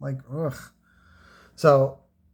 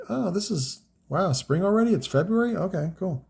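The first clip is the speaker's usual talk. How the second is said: pleasantly puzzled, lightly imitated in a higher pitch; mildly surprised; 6 semitones higher than usual. Seller imitates locals noticing spring came early, mildly surprised.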